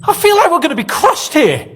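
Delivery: high, fretful complaint; panicky. Acted. Prisoner tells his cellmate they will be crushed, panicky. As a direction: sound sure the walls will crush you.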